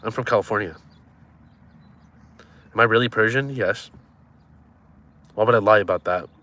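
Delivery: usual speaking voice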